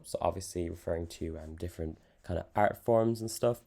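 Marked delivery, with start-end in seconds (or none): none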